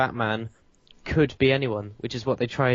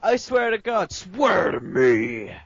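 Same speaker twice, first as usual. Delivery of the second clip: loud, slow strained Batman growl; gravelly voice; 6 semitones higher, 3 dB louder, slower than usual. Retold or imitated. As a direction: stretch every word in a Batman rasp. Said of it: "a gravelly voice".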